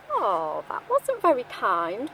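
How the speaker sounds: plain reading voice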